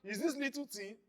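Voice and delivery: loud contemptuous jab; scornful husband. Retold or imitated. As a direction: bark it down at her like she's overreacting. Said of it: "you don't say".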